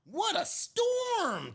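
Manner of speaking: high, slow little bird voice; twittering wren. Acted. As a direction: chirp it like a tiny wren. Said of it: "twitters wren".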